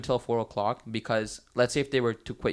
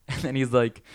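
normal talking voice

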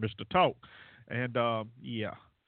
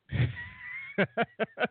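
laughs. Real, not acted. Host laughing at himself.

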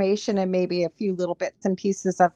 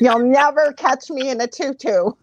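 laughing; 4 semitones higher, 5 dB louder than usual. Real, not acted. Joking refusal, laughing.

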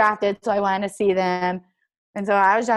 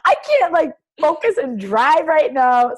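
loud, high, retold protest; overwhelmed; 8 semitones higher, 7 dB louder than usual. Athlete retelling struggling to drive home, comically overwhelmed. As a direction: re-enact a frazzled plea, voice pushed up.

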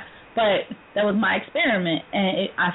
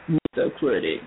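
lower, gruff announcer-style name drop; Mister Critic imitation; 7 semitones lower than usual. Host introducing co-host, low imitation of him. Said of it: "That was my Mister Critic imitation".

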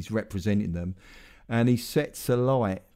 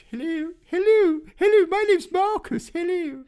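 very high, prim posh schoolboy greeting; imitating his old elocution-lesson self, pitched way up; 4 dB louder than usual. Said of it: "so I used to speak like that".

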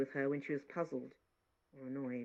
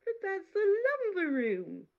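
put-on much higher, louder astonished girl; surprised; 7 dB louder than usual. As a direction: protest in surprise.

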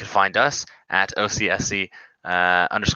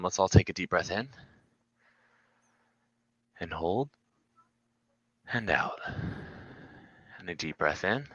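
guided breathing; 7 dB quieter than usual. Pretend. Leads a breathing exercise.

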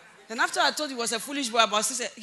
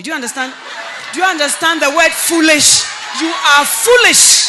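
put-on loud, high scolding of a foolish boy; much louder, higher rebuke; angry scolding shout; 5 semitones higher, 15 dB louder than usual. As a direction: shout it down at him like a furious mother. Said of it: "I've never heard you speak like that".

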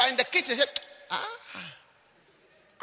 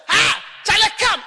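calling a member; 11 semitones higher, 5 dB louder than usual. Retold or imitated.

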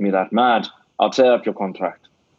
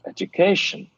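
ordinary talk

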